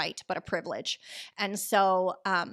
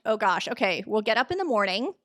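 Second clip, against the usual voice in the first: ordinary talk, nothing marked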